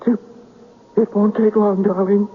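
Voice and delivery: a man torn apart by grief; anguished. Acted. Man resolving to join her.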